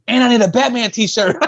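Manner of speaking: put-on high kid voice through a laugh; laughing while imitating his eager younger self; kid asking. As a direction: ask like a hopeful kid, laughing. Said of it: "I'm like".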